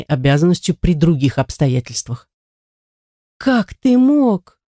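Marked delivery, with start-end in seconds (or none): 3.4-4.6 high, slow, dismayed question; lost and disbelieving, pitch raised; bewildered. A narrator's reading. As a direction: draw it out in helpless confusion. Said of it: "растерянно промолвил Дэми".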